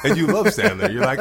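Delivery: loud, high, laughing words. Real, not acted. Comedian amused by Sandler's adoring crowd, laughing. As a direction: let laughter carry the line.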